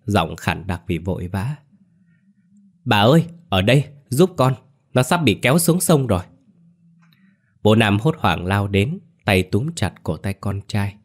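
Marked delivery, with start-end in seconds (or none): 2.8-6.2 higher, fast, panicked plea; urgent cry for help. A narrator's reading. Girl begging grandmother to help save Nam, urgent cry. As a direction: call out fast and high for help.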